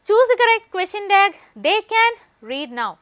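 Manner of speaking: high, loud and very slow, word by word; dictating an exam question slowly; slow question read-out. Shown as a demonstration. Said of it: "reading the exam question aloud".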